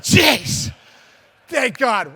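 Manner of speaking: loud, high burst of relief; relieved exclaim. Retold or imitated. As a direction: let the relief explode out, loud. Relief at not being released.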